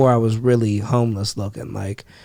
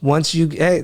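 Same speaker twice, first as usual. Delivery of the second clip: ordinary talk, nothing marked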